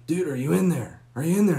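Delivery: loud, high calling through the door; retold yell. Buddy calling through the locked door, yelling. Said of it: "And he's yelling".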